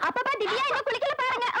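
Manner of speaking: high-pitched singling-out call; tattling call. Acted. Girl telling father that Divya hasn't bathed, tattling.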